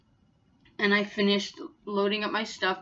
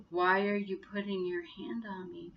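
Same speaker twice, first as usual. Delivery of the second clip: slow and soft, dazed; stunned slow calm question; slow-motion calm; 6 dB quieter than usual. Retold or imitated. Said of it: "Kinda like that, like I was in slow motion".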